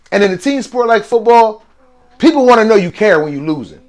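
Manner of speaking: ordinary delivery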